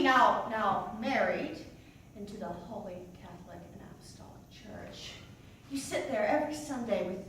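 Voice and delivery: ordinary delivery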